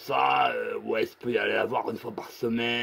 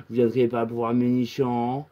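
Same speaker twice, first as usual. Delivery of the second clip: ordinary talk, nothing marked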